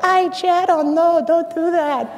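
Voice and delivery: put-on high, panicked Venezuelan accent; imitating a Venezuelan mom freaking out; Venezuelan mother. Son imitating his Venezuelan mother catching him, panicked Venezuelan accent. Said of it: "thoroughbred Venezuelan, thick accent".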